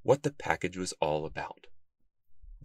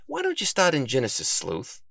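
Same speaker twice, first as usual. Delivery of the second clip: suggesting bookworm; 6 semitones higher than usual. A narrator's reading. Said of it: "Bookworm suggested".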